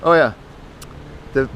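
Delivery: usual speaking voice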